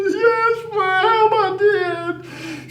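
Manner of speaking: high, tearful and fading; crying confession. Retold or imitated. Boy admits killing the rooster to Granny, crying. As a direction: sob out the admission. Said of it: "I'm just crying and crying".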